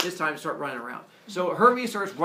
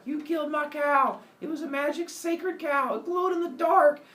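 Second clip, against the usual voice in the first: very high, loud outrage; furious Apollo; 12 semitones higher, 4 dB louder than usual. Acted. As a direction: rant about the magic cow. Apollo flips out.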